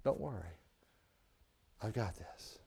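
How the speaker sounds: soft, low, gentle promise; reassuring God. Acted. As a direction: comfort a worried child, softly. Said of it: "He says".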